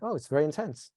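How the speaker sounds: calm, accepting acknowledgement at normal pitch; composed and matter-of-fact, slightly soft. Shown as a demonstration. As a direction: acknowledge it evenly, unworried. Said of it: "Until you calm, like".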